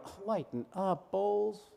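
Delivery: a hint of an eye-rolling skeptic; drawn-out shrugging dismissal; mock dismissive audience.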